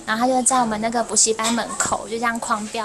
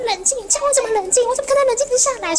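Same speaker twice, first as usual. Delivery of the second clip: much higher, indignant mock rant; retold angrier outburst; 12 semitones higher, faster than usual. Teacher voicing a father told to calm down, mock outrage. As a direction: imitate an incensed dad, pitch shooting up. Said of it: "但他更生气".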